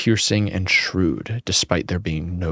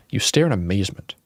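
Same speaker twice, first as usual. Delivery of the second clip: usual speaking voice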